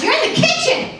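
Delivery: high mocking teen voice; daughter's retort. Daughter snapping back at mom about the kitchen, high mocking voice. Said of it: "She goes".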